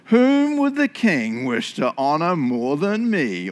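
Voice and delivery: slow, drawn-out pomposity; self-satisfied Haman. Acted. Fat-headed Haman thinking the king means him, pompous. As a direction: swell with hammed-up self-importance. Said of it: "Haman with a giant fat head thought to himself".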